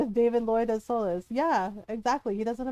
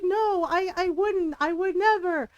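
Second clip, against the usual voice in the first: high, louder, flustered denial; Solus protesting; 7 semitones higher, 5 dB louder, slower than usual. Retold or imitated. Solus denying he poisoned Llewellyn, high imitated protest. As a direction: deny it urgently, pitched up. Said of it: "you can hear his voice".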